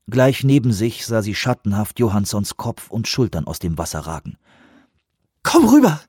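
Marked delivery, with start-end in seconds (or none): 5.4-6.0 high, loud urgent call. Acted. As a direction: call him over, urgent. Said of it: "rief sie".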